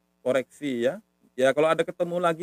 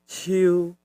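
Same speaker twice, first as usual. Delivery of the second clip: ciu smooth and level; rather soft tone. Shown as a demonstration. Teacher repeats beard word, ciu smooth and level. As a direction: keep ciu on an even mid note. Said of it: "Agak halus".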